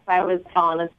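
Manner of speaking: normal talking voice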